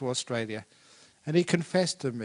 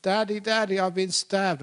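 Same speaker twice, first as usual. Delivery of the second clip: high-pitched imitation of a boy calling his father; storyteller's hurt little boy, high and urgent; distressed child; 7 semitones higher, 4 dB louder than usual. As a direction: cry out to Daddy for help, pitched up.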